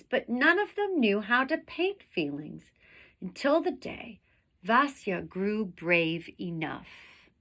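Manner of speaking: lively read-aloud storytelling voice; expressive children's story reading; story narration. A narrator's reading. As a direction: read it to the class like a picture book. Reads picture book aloud.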